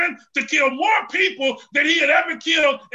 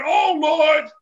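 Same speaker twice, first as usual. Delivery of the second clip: loud, high call; loud prayer; crying out to the Lord; 4 semitones higher, 5 dB louder than usual. Acted. Samson's prayer voiced in sermon.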